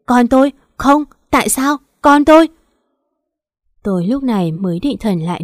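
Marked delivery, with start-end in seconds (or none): 0.1-2.5 high, loud grief-stricken cry; a mother wailing over her lost baby; anguished wail. Acted. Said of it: "máu me ướt đẫm gào lên". (gào lên đau đớn, cao và to)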